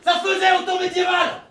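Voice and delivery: high-pitched declaring; mock proclamation. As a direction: announce it at the top of the voice. Shouting explanation in improv.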